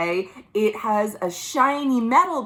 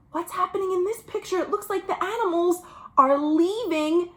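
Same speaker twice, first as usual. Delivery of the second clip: surprised gasp; 6 semitones higher than usual. Real, not acted. Reacts to picture.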